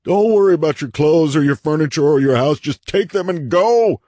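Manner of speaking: high, loud, anguished urging; desperate shout. A narrator's reading. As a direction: cry out as a frantic plea. Half-sobbing shout continues.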